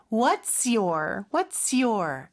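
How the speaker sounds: "What's your" with the final s sliding into your; linked pronunciation. Shown as a demonstration. Teacher showing learners the linking, "What's your" joined through the s. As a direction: run "What's your" together, slowly linking the s into the y. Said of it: "S 발음으로 살짝 연음시켜주시면".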